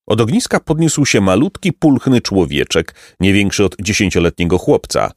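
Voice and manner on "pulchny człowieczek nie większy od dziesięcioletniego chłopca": usual speaking voice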